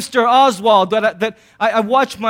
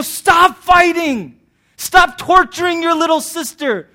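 louder and higher, very slow and stern; mock parent yell; 6 semitones higher, 4 dB louder, slower than usual. Parent telling the kids to stop fighting, stern mock yell. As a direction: bark it like a fed-up parent.

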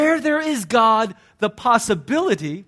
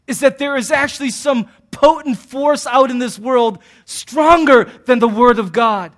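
normal talking voice

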